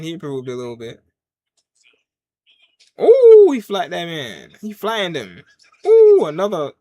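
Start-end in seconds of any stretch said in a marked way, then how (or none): 3.0-3.5 long, high, loud "ooh"; excited ooh. Real, not acted. Watcher reacts as he flattened that man, excited ooh. Reacting live to wrestling move. 5.9-6.2 high, loud drawn-out "ooh"; excited ooh. Real, not acted. Watcher sees another hit in the match, excited ooh. Reacting to another wrestling move.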